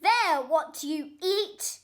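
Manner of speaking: very loud, high, slow bratty yell; a spoilt boy bellowing orders; furious child's shout. A narrator's reading. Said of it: "Roy shouted".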